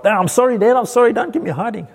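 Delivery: put-on high frightened child begging his dad; scared boy pleading. Young boy begging dad not to punish him, high and frantic. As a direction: plead like a boy about to get the belt. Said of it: "I was like".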